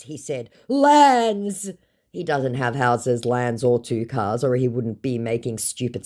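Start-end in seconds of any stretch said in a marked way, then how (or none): 0.7-1.7 high, stretched put-on brag; mock boastful scammer. Scammer listing possessions, mock boastful. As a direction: drag out the single word proudly.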